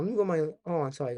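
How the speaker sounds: ordinary delivery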